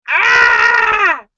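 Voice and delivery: loud, high, drawn-out shriek; mock horrified scream. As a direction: scream in comic horror. Acted reaction to child's awkward question.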